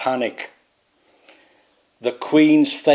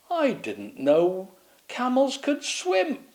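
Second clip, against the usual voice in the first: high, slow, wondering quip; puzzled knight; 12 semitones higher, 3 dB quieter, slower than usual. Acted. Burpalot quipping to Swaggeralot in a high, slow, puzzled voice. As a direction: muse it innocently, drawn out.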